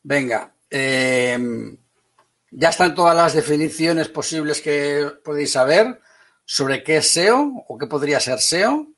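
ordinary delivery

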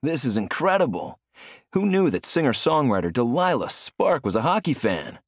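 excited commentator. Acted. TV commentator announcing a celebrity in the crowd, excited. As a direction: sell it like a TV sportscaster. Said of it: "the commentator says".

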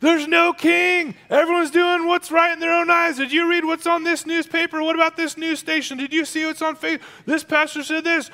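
very high, loud mock panic; hammed-up freak-out. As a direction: rattle off worries in a hammed-up frantic pitch. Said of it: "where he's freaked out".

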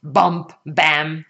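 plain reading voice

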